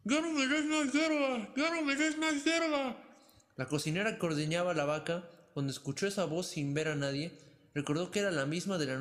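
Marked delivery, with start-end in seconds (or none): 0.0-3.0 high, slow, desperate yell; terrified shout. A narrator's reading. Pulgarcito trapped in the cow's belly, terrified high yell. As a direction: scream it out in fear. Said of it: "muerto de miedo, se puso a gritar con todas sus fuerzas".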